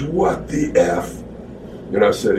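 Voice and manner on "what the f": slow, drawn-out, put-on voice; stunned Kev. Kev seeing the smashed face, slow stunned voice. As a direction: gape at the damage.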